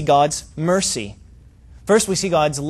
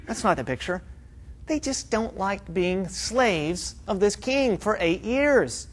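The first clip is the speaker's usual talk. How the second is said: ordinary delivery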